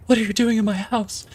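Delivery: high-pitched imitation of the heroine; high mock scared woman. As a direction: squeak it out like a frightened homeowner. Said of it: "she's like".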